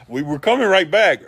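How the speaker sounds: loud, high-pitched self-defense; mock protest. Storyteller excusing himself for locking the guide in, loud mock protest. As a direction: protest it loudly, jumping up in pitch.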